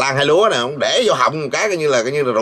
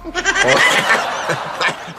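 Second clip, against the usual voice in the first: high-pitched burst of laughter; 7 semitones higher than usual. Real, not acted. Cười nhạo sau khi nhại. (cười phá lên chế nhạo)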